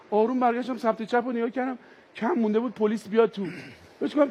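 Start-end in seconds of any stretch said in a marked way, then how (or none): none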